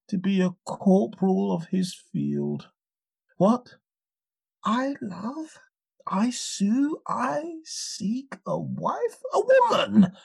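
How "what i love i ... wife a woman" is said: high, disbelieving cries; incredulous outburst. Acted. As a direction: spit each question out in disbelief. Berowne aghast at being in love.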